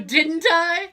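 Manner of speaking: amused, laugh breaking into the words; laughing reply. Real, not acted. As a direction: say it rising through a laugh. Joking reply while cracking up.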